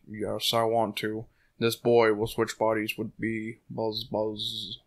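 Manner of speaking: lightly voiced bee-boy, sly and hushed; creepy bee voice. Voicing a bee-boy in a book.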